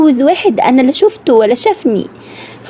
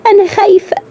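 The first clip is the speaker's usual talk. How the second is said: أنا خايفة pitched much higher, voice rising in fear; frightened; 8 semitones higher than usual. Acted. Bride tells her sisters she dreads the groom, pitched-up fear. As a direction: let the fear break through on أنا خايفة. Girl dreading unknown old husband.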